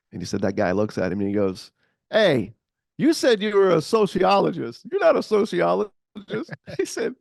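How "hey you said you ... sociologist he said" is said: high, loud, amused gotcha; accusing call-out. Retold or imitated. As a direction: catch him out, laughing. Diner owner calling out Tony.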